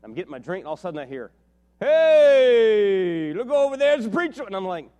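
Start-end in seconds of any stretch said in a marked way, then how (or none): 1.8-4.4 long, loud, high holler; heckler calling out. Retold or imitated. Man at gas station pointing out the preacher, jeering holler. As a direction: holler it across the room, imitating a jeering man.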